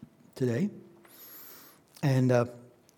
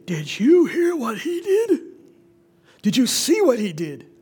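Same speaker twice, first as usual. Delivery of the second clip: much higher and louder, slow and wide-eyed; amazed gossip; 12 semitones higher, 8 dB louder than usual. Acted. Townspeople telling each other about the miracle, amazed and loud. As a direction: marvel out loud like a stunned townsman.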